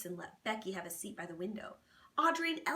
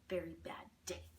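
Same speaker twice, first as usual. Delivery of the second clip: whispering; 5 semitones lower, 8 dB quieter than usual. A narrator's reading. Refrain whispered.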